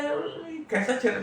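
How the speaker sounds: high, startled question about the face; a teasing gasp at the odd face; mock surprise. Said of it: "सब student बोलते हैं". (छात्रों का हैरान, ऊँचा सवाल)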